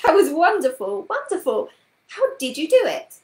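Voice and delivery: high, loud cry of wonder; excited cry. A narrator's reading. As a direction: marvel out loud, breathless with amazement. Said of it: "cried Carrie with a gasp".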